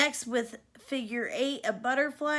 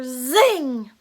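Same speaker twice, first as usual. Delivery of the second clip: pistol zing; 11 dB louder than usual. A narrator's reading. Magic pistol fires.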